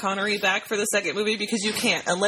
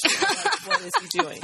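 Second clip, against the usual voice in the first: much higher, exasperated disbelief; incredulous; 12 semitones higher, slower than usual. Real, not acted. Host baffled by the fake Scottish accent, high and incredulous. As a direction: ask it like you can't believe it. Mocking an actor's failed accent.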